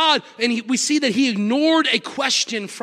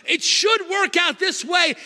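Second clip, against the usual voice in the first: shouted climax; 7 semitones higher, slower than usual. Acted. Climax of the preached quote.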